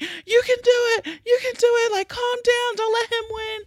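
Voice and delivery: high-pitched cheering friend voice. Retold or imitated. Friend on the train cheering me on, high and squeaky. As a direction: cheer her on in a squeaky, egging-on voice. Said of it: "cheering me on".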